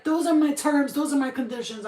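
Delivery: firm ultimatum. Retold or imitated. Retelling her terms to the giant, firm ultimatum. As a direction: lay down terms, mock forceful. Acted negotiation, laying down terms.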